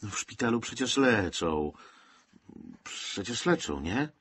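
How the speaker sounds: hesitant comfort, trailing into a question; half-hearted, doubtful soothing; unconvincing reassurance. A narrator's reading. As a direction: reassure without believing it yourself. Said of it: "Mało przekonującym tonem powiedział Rudowicz".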